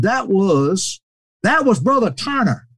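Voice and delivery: high-pitched impression of an older preacher; high, emphatic phone voice; Brother Cates. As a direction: recall it in a high, animated voice. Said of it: "He said".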